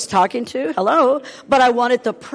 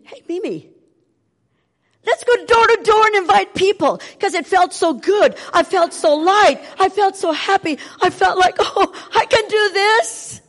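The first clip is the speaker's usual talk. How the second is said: high, loud joyful rush; elated; 11 semitones higher, 6 dB louder than usual. Retold or imitated. Telling the listeners how light she felt, high and elated. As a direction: burst out with happiness. Overjoyed recollection, much higher.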